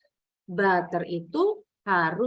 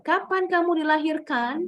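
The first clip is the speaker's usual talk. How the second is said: higher pitch, lifting up to "dilahirkan"; rising question intonation; 6 semitones higher, 3 dB louder than usual. Shown as a demonstration. Teacher shows Indonesian WH questions go up, rising intonation. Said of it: "Sama intonasinya naik".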